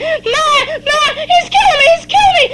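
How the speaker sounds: put-on high-pitched child's scream for help. Child calling her mother during a beating, screaming. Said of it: "And I'm screaming".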